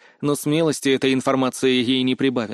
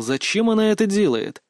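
ordinary delivery

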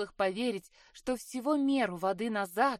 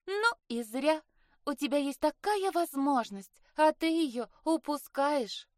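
higher-pitched sisterly scolding; reproachful sister; 6 semitones higher than usual. Acted. Sister chiding her for missing the chance to dance, reproachful. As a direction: chide her in a raised, lively pitch.

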